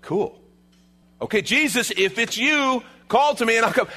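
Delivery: high, eager mock volunteering; playfully gung-ho disciple; keen Peter. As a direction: call out as the keen first volunteer. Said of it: "Peter's first thought is".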